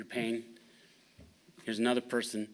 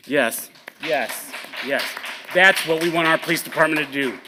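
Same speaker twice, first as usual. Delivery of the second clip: much louder, rising with delight; enthusiastic cheer; 2 semitones higher, 10 dB louder than usual. Real, not acted. Councilman praising the police for towing the truck, loud and delighted. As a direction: whoop it up, thrilled.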